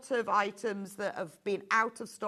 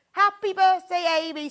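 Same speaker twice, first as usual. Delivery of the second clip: excited birthday greeting; 10 semitones higher, 11 dB louder than usual. Real, not acted. On-air birthday wishes to designer.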